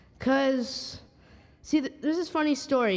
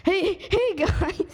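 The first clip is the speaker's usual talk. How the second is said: higher, louder attempt at a duck voice; Donald Duck voice; 7 semitones higher, 4 dB louder, slower than usual. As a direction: try a cartoon duck, loud and high. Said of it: "do your best Donald Duck impression".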